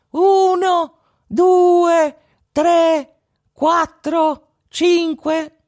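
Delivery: tiny high voice echoing the count, loud; mocking echo. A narrator's reading. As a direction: mimic the robber's counting from the bush. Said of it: "gli faceva il verso". (fa il verso con vocina acuta)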